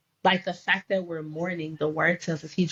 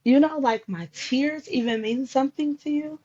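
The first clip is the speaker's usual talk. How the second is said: high-pitched amazed asking; retold disbelief, voice lifted high; incredulous question; 8 semitones higher than usual. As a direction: climb high in wonder, asking the Lord.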